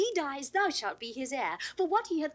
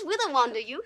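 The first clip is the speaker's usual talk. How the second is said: usual speaking voice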